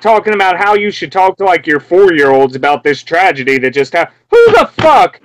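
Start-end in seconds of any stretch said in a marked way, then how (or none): 4.3-5.2 loud, high burst of disbelief; exasperated shout; outraged. Real, not acted. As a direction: explode in indignation. Ranting about advice on the news.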